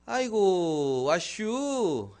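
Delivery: slow, high, loud country greeting drawled out; hairdresser's rustic dialect. Retold or imitated. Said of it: "자리에 앉으니까 주인이 와서".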